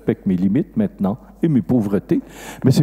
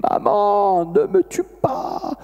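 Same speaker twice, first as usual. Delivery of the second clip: put-on high, drawn-out child's plea; crying child; 8 semitones higher, much slower than usual. Unborn child pleading with the mother, high imitated cry. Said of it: "on entendait la voix de son enfant qui criait".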